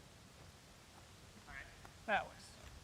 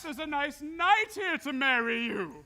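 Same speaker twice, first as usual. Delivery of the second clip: ordinary talk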